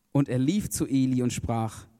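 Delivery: ordinary delivery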